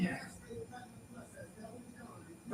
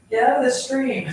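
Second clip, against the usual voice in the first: loud, higher call to the dog; calling from far away; 6 semitones higher, 5 dB louder than usual. Retold or imitated. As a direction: yell at the dog in the stream. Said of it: "calling him in the yard".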